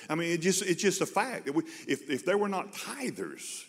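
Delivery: rising pulpit fervour; preaching at full force. Acted. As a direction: drive the point home from the pulpit.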